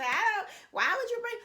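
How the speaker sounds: high, disbelieving mock question; imitating a puzzled skeptic; incredulous question. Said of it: "some people say".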